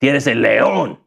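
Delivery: put-on lightly Cuban Spanish accent. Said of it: "how would you say it with a Cuban accent?".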